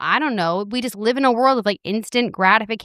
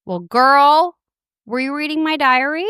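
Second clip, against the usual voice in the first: higher, louder playful shock; amused surprise; 5 semitones higher, 3 dB louder than usual. Real, not acted. Surprised at listener question.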